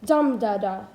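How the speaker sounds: Dum-da-da, strong beat then two light ones; stress on Dum. Shown as a demonstration. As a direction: punch the Dum, let da-da fall away. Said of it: "one stressed syllable followed by two unstressed".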